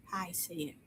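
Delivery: breathy unvoiced whisper; whispered aside. Real, not acted. Preacher whispers to himself, a hushed aside. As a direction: whisper it under your breath. Quiet aside mid-sermon.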